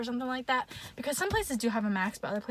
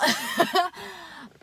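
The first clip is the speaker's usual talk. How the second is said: embarrassed laugh; 8 semitones higher, 3 dB louder than usual. Real, not acted. Laughing at her own story.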